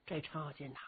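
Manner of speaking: ordinary delivery, nothing marked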